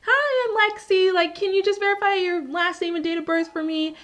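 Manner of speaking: high, sing-song customer voice; cheerful professional greeting; bright nurse voice. Retold or imitated. Retells greeting patient in nurse voice.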